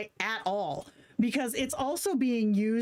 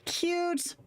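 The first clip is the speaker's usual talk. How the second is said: delighted; 9 semitones higher than usual. Real, not acted. Reacting to the AI's sweet message.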